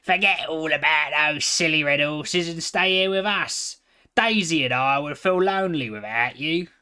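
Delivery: gruff friend urging him to stay; grumbling piglet. A narrator's reading. Piglet telling the pony to forget the horses, gruff.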